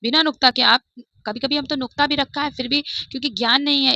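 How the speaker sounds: normal talking voice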